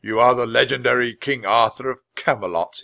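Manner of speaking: a touch of grand mockery; mocking. A narrator's reading. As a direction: taunt the king with grand disdain. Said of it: "he said in a mocking voice".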